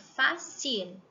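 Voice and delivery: fácil with the final L said clearly; Spanish fácil said as a teaching model. Shown as a demonstration. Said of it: "É só pra vocês verem o movimento".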